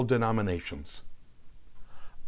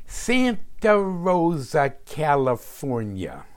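put-on stretched-out vowels in a Brooklyn accent; sarcastic mother, drawing out "Santa Rosa" Brooklyn-style; sarcastic Brooklyn drawl; 4 semitones higher, slower than usual. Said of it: "sarcastically extending the vowels in her Brooklyn accent".

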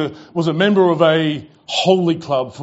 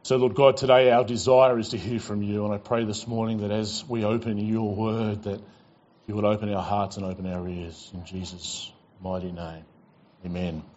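hushed, earnest prayer that fades softer and lower toward the amen; softly spoken pastor's prayer, sinking lower and softer at the close; opening prayer; 7 semitones lower, 4 dB quieter than usual. Real, not acted. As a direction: pray quietly over the congregation before the sermon.

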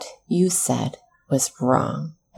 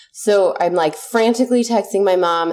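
ordinary delivery

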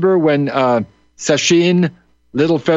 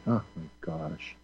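hushed, low breath of disbelief; exasperated whisper; 10 semitones lower, 18 dB quieter than usual. Acted. Host reacting to condescending amazement, whispered exasperation. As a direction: drop to a near-whisper in weary disbelief.

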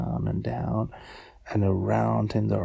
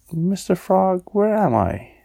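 higher, louder questioning little voice; little shrimp character; 8 semitones higher, 6 dB louder than usual. Acted. Lost Tiny asks the frog for help, high questioning voice. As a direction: call up to the frog, puzzled.

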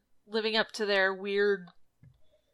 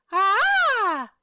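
excited anime fan; 10 semitones higher, 4 dB louder than usual. Acted. Outburst during poem reading.

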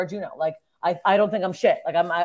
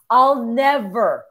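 slow, louder, drawn-out furious vow; angry vow; 6 semitones higher, 6 dB louder, slower than usual. Retold or imitated. Comedian reenacting telling off the booker, slow and loud. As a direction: spit each word out like a final goodbye. Retold blowup at club owner.

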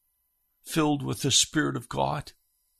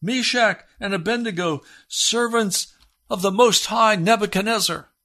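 higher, mock-grand summons of the wrong master; mock king's call; 8 semitones higher, 3 dB louder than usual. Preacher mocking what the king didn't say, lightly voiced. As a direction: ham up the king's proud call. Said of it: "he does not say".

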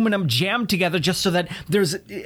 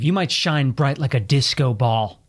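ordinary talk, nothing marked

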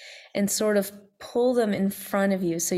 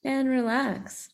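guided meditation; 4 dB quieter than usual. Acted. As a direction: gently bring them back. Closing the meditation softly.

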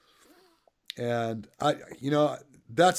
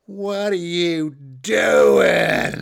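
loud, drawn-out whiny holler from a hilltop; imitating a whiny voice blaring on a bullhorn; whiny bullhorn heckle; 5 semitones higher, 10 dB louder, much slower than usual. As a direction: stretch it out like a whiny taunt through a bullhorn. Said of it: "I hear this little whiny voice up on a hill".

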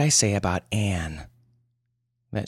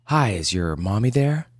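ordinary delivery, nothing marked

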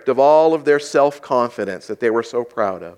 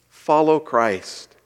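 slow refrain; much slower than usual. Shown as a demonstration. Repeated slowly as a refrain.

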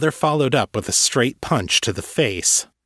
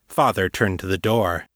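ordinary delivery, nothing marked

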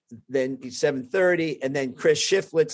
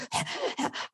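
mock frantic panting; winded, wheezy huffing from a sprint; breathless gasping; 9 dB quieter than usual. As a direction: gasp for air instead of answering. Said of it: "I'm barely breathing".

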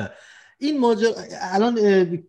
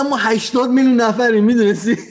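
laughing retort; 7 semitones higher, 5 dB louder than usual. Retold or imitated. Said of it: "گفتم".

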